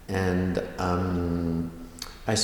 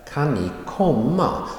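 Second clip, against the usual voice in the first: higher pitch, short Kan ni, rising komma; stress on komma; 6 semitones higher than usual. Shown as a demonstration. Teacher repeating the main-verb example, stress on komma. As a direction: put the weight on komma, lifting it. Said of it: "That gets a stress".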